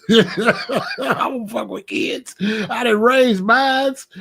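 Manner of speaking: slow, drawn-out through laughter; laughing repeat. Real, not acted. Grandpa repeats it to the camera, laughing slowly.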